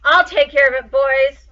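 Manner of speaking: put-on loud, confident call to the boys; hero's shout. A narrator's reading. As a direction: boom it out to reassure them. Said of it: "Mose shouted".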